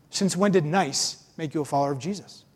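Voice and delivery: ordinary talk, nothing marked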